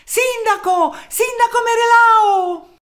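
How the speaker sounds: very high, drawn-out shout; shouting the name. A narrator's reading. Townsmen arrive waving at the mayor, high shouting. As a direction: yell for the mayor across the square. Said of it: "urlando il suo nome".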